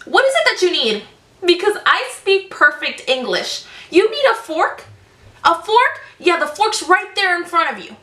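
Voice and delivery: mock-patient but fed-up reply to the customer; exasperated sass. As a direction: let the annoyance climb high on each question. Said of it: "I was like".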